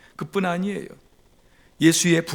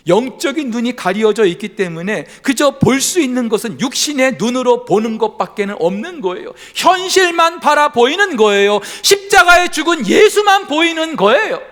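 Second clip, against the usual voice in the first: loud, high, building sermon climax; fervent preaching; 8 semitones higher, 9 dB louder than usual. Acted. Preacher builds to a fervent climax before the congregation. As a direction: preach it out with full force.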